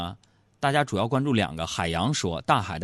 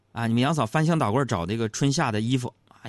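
ordinary talk, nothing marked

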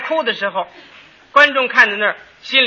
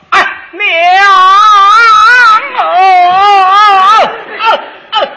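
mourner crying mama in high opera style; opera-style wail; 10 semitones higher, 9 dB louder, much faster than usual. Retold or imitated. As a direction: wail at the coffin like an opera singer. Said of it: "孝子一看这棺材一难过，".

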